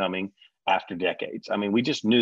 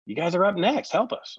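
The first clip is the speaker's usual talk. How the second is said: high, louder mock plea; producer pleading; 9 semitones higher, 4 dB louder than usual. Producer asking John to get the cast, high plea. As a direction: beg him to help, overplayed.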